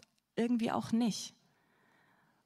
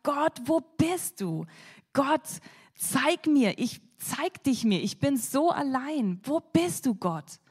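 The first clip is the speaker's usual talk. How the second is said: mild despairing plea; 5 semitones higher, 4 dB louder than usual. Acted. Elijah's despairing cry to God.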